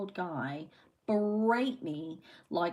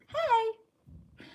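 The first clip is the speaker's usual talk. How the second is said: chirpy hello; 3 dB louder than usual. Acted. Reading a viewer's greeting aloud.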